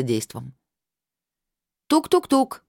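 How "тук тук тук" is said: high sing-song knock call; loud calling out. A narrator's reading. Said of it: "громко позвала я".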